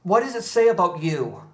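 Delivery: usual speaking voice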